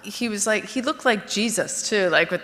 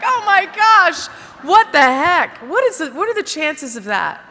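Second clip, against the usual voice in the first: amazed disbelief; 7 semitones higher, 4 dB louder than usual. Retold or imitated. Women marvel at the coincidence.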